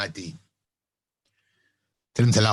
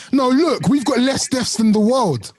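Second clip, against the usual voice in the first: high-pitched, incredulous mock quote; Trump; 9 semitones higher, slower than usual. Co-host acting out Trump with his paper, high mocking voice. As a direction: pitch it way up and stretch it, imitating his protest. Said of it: "he took a piece of paper and he said".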